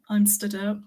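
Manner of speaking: usual speaking voice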